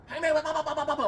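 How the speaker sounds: high, loud mock rapid babble; high and loud, gabbling blah blah like a fast talker; rapid-fire friend. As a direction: imitate a friend who talks a thousand miles an hour. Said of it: "he spoke a thousand miles an hour".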